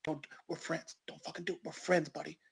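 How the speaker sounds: hushed, pleading whisper; urgent whispered plea. Acted. Homeless man begs Bobby not to tell mom, urgent whisper. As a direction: whisper it so the phone won't hear.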